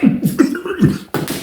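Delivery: high-pitched mouth sound of scuffling; imitated fight noise. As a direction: mimic the noise of blows landing. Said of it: "it was like".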